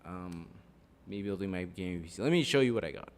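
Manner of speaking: booming ring announcer welcome; lucha libre announcer. Acted. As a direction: bellow it to the arena. Redone stream intro.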